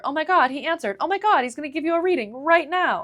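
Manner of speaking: hammed-up thrill at the phone call; gushing excitement. Imitating the woman gushing to her about the astrologer, mock excited.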